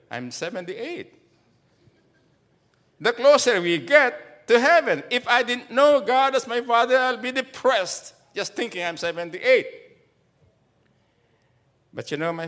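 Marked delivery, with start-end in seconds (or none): 3.0-9.7 raised, high fervent preaching. Acted. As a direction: proclaim it to the congregation. Preaching at full force.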